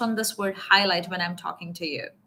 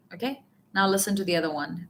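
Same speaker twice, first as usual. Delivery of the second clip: ordinary talk, nothing marked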